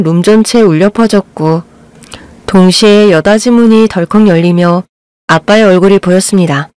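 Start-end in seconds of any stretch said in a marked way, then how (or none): none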